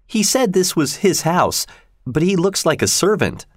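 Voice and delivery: put-on high, brightly chattering girl. A narrator's reading. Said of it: "continued Kathy brightly".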